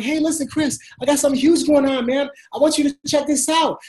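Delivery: high, eager phone pitch; excited. Shown as a demonstration. Coach demonstrating a call to Chris about something huge, excited. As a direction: sell it with pumped-up excitement. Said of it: "you see the excitement in my voice".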